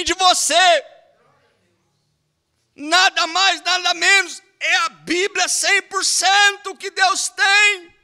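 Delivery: fervent preaching, high and loud; preaching at full force. Acted. Preacher urging the church to want the whole Bible, fervent preaching. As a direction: preach it to the congregation with fire.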